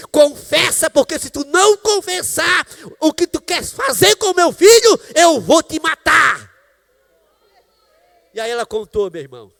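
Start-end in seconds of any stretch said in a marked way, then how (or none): 0.1-6.5 loud, slow, menacing shout; threatening shout. Retold or imitated. Man threatening the mother, slow loud shout. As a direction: bellow the threat slowly, word by word.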